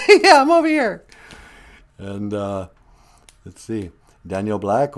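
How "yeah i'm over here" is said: much higher, louder squeaky pet voice; pet's voice. Pretend. Host greeting viewers on behalf of his pet, much higher cutesy voice.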